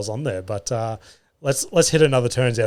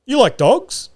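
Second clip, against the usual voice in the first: pitched-up innocent question; high mock innocent; 11 semitones higher, slower than usual. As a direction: ask it sweetly and very high, like a sudden idea. Absurd question to telemarketer.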